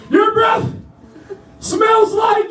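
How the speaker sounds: very high, strained answer; imitated nervous recruit. Scared trainee answering sergeant about his breath, high strained voice. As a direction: strain upward and leave it hanging.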